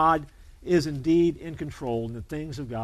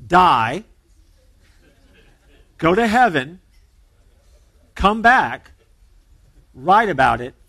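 each step hit louder, with pauses between; punched recipe steps; 6 dB louder, slower than usual. Shown as a demonstration. Pastor mocking the bestseller recipe, each step punched louder. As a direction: count off every step like a mock recipe. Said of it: "here's how you do it".

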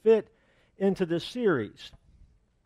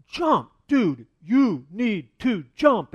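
louder and higher, slow urgent shout of jump; urging shout; 4 semitones higher, 6 dB louder, much slower than usual. Retold or imitated. Firefighters below shouting up to the trapped man, loud and urgent. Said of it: "they're like".

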